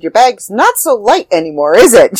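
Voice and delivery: put-on ribbing a young reader about her heavy bag, playfully; playful teasing. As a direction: tease her with a grin. Teasing the girl in passing.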